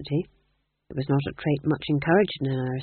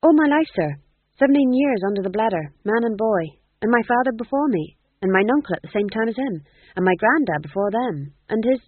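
high, rushed nervous jester; flustered bumbling goofy; 5 semitones higher, 5 dB louder than usual. Acted. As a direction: babble on anxiously. Fool babbles his lineage.

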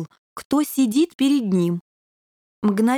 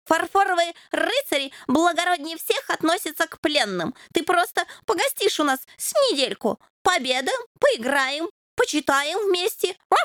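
much higher, eager little knight; proud puppy; 6 semitones higher than usual. A narrator's reading. Puppy tells the devil how prisoners are treated, proud. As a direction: promise happily, proud of his order.

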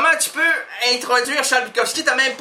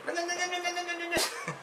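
quieter engine-like non non; motor imitation; 7 semitones higher, 13 dB quieter, faster than usual. Guest imitating an old car motor for the host. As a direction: hum the no's like an idling old engine. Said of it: "l'entendez encore murmurer".

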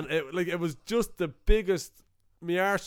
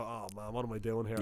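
much softer, low, deflated; glum mutter; 5 semitones lower, 9 dB quieter than usual. Retold or imitated. A bored audience member to himself, soft dejected mutter. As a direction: mumble it to yourself, regretting coming. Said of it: "sit there and kinda go".

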